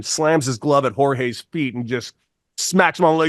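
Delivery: ordinary talk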